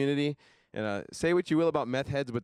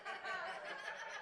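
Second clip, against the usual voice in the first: high giggle; 16 dB quieter than usual. Real, not acted. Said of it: "[name] thinks it's funny".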